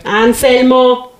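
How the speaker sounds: wife slowly warning her husband to stop joking about food. Acted. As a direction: stretch the name slowly like a final warning. Wife warns husband again.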